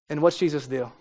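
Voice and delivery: usual speaking voice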